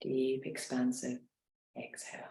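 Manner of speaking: guided meditation. Acted. Leads a guided relaxation.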